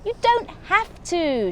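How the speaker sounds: high, slow, smiling reassurance. Acted. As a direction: say it through a sweet smile. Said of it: "she smiled".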